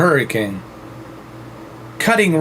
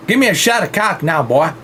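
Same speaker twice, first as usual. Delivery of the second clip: put-on Southern accent. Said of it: "[name] Southern accent".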